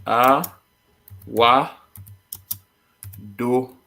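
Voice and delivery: slow Jamaican-style syllables; Jamaican phrase. Shown as a demonstration. Teacher sounding out a Jamaican phrase for a learner. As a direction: spell out the Jamaican phrase slowly. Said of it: "Jamaican too".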